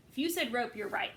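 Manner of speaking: usual speaking voice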